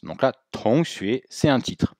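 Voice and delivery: ordinary talk, nothing marked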